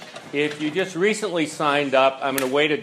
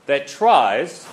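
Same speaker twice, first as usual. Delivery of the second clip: tries pushed out louder; emphasis on tries; 4 dB louder than usual. Shown as a demonstration. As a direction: hit the word tries. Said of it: "I'm gonna emphasize the word tries there".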